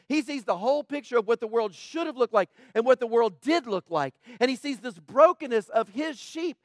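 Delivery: loud, high, driving sermon delivery; preaching at full force. Acted. Pastor urging the congregation about a broken world, loud and fervent.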